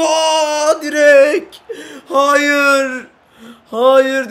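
loud, high cry as the shot hits the post; frustrated shout. Real, not acted. Player shouting as his shot hits the post. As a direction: yell out in dismay at the miss.

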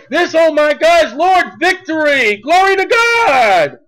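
high, rising preacher's exclamation; full-force preaching. Real, not acted. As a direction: proclaim it in triumph, stretching the last words.

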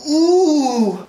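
long, loud, high ooh gliding down. Real, not acted. Vlogger discovering what is inside the box, high ooh.

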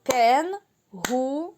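Кенгуру said very slowly, syllable by syllable; clapped syllables. Shown as a demonstration. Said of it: "Плескаємо в долоні". (повільно, по складах)

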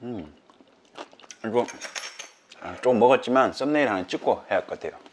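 chewing while talking; speaking between bites; mouth full. Real, not acted. Seen 0: Puts chili-coated piece in mouth at 4.6, chews through 5.8.

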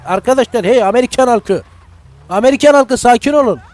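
loud call to the crowd. Pretend. Streamer calls to the game crowd, loudly. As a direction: shout over the panicking crowd.

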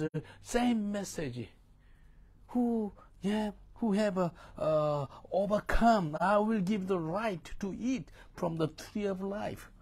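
loud, high, ringing preacher's cry; fiery sermon voice at the top of the lungs; full-force preaching. Acted. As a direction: preach it out to the whole hall.

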